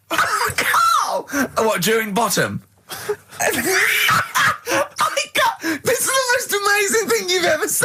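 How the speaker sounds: very high, squealing with laughter; crying with laughter; hysterical laughter. Real, not acted. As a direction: lose it completely. [name] in hysterics.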